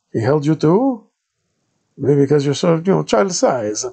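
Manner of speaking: put-on high, loud thick Italian accent of a friar; Italian-accented padre greeting a stranger. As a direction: play the monk in a heavy Italian accent. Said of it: "the thickest Italian accent I'd ever heard".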